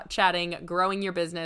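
normal talking voice

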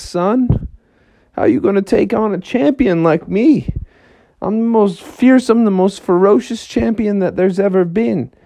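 lightly voiced cocky frog bragging; boastful frog. Frog boasting to the elephant, lightly voiced. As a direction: puff up like a braggart champion.

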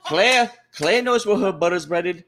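high, loud Southern preacher; Southern accent. Pretend. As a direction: wail it like a mock Southern revivalist. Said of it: "It's a Southern accent".

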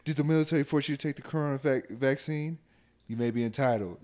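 fast, loud TV lawyer pitch; injury lawyer ad. Retold or imitated. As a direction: pitch it like a late-night lawyer ad. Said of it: "that commercial on Facebook".

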